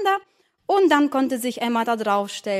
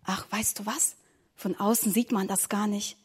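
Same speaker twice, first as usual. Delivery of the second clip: hushed reassurance; 3 semitones lower, 8 dB quieter than usual. Acted. Reassuring quietly.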